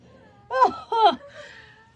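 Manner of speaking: loud laughing burst; guilty laugh. Real, not acted. Parent laughing guiltily at the fight. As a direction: try not to laugh and fail. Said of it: "I shouldn't be laughing".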